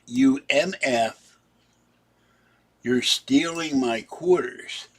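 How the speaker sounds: imitating a raving man yelling, slowed down; retold yell. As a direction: drag out the insult like a ranting stranger. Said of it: "He screams and yells at the top of his lungs".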